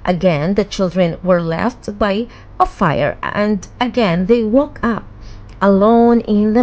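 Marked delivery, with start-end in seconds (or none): none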